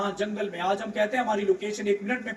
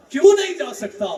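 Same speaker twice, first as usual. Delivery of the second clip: pressing question; 8 semitones higher, 8 dB louder than usual. Acted. Umar pressing the refusal.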